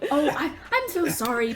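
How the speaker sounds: a shopkeeper uncle covering for his nephew, laughing; flustered uncle. Acted. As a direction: apologize with a nervous laugh. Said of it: "Pip says to you guys".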